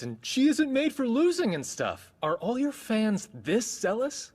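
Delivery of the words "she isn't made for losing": mocking devoted fan. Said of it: "It's all".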